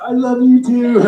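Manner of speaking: loud, very high hammed-up wail; mock crying. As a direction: blubber it back for laughs. Answers crying coach, exaggerated high cry.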